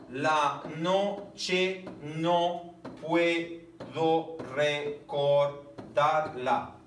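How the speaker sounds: very slow, each syllable drawn out; slow verse reading. Shown as a demonstration. Teacher reading a verse slowly so students count syllables. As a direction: pull the line apart syllable by syllable. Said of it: "Uno, dos".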